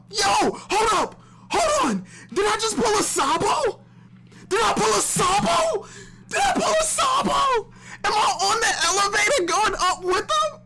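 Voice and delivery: shrill, very high yelling; excited shouting. Real, not acted. Fan yelling at a twist with his character. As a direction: freak out with excitement.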